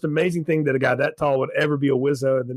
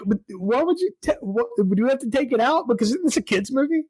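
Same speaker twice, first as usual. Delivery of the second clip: higher-pitched incredulous questioning; baffled questioning; 7 semitones higher than usual. Retold or imitated. Host replaying their reaction to the unwrapped prop, baffled questioning. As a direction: question it in high-pitched mock disbelief.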